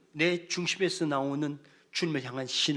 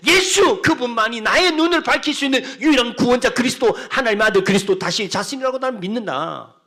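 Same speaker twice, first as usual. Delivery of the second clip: loud, higher preaching at full force; fervent preaching; 6 semitones higher, 11 dB louder than usual. Acted. Pastor urges the congregation toward bold faith, loud preaching. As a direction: preach it with rising fervour.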